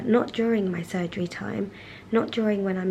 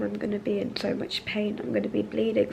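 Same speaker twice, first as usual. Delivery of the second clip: ordinary talk, nothing marked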